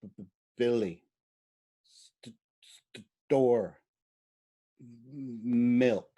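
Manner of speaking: halting, broken-off word starts; stuttering aphasic speech. Shown as a demonstration. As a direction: stammer out single words. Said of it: "you would say".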